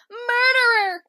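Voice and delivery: high, loud crying scream; screamed accusation. Retold or imitated. Kira screaming murderer at Ward Cameron. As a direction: shriek the accusation. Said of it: "Kira goes like".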